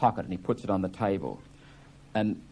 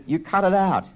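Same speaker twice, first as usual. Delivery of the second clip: telling him off; 9 semitones higher, 5 dB louder than usual. Acted. Happy shouts at Biff.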